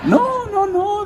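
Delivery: high godmother voice correcting him. Retold or imitated. Godmother correcting the actor's wrong guess, in a very high put-on voice.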